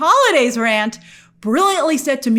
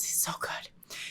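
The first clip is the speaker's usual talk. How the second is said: whisper; 18 dB quieter, slower than usual. Real, not acted. Admiring aside about actor.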